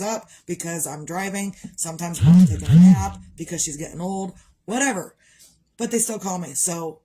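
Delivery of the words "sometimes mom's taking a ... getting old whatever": put-on loud laughing "nap" and "old"; mock indignant. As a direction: joke about being old, laughing through it. Mock complaint about getting old.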